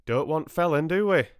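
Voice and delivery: loud, high country voice; rustic farmer. A narrator's reading. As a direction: check heartily, like a farmer. Farmer chats in dialect.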